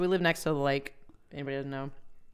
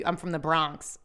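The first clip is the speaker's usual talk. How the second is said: ordinary talk, nothing marked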